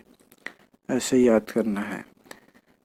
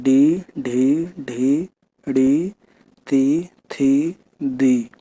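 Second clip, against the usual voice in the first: each syllable drawn out slowly on its own; slow syllable reading; much slower than usual. Shown as a demonstration. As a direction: read the syllables one by one slowly for the pupils. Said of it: "एक बार ऐसे भी पढ़ लेना". (धीरे-धीरे एक-एक अक्षर पढ़ना)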